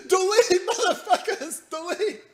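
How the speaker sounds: high squeaky mock panic; high mock cry of delete; mocking panicked guy.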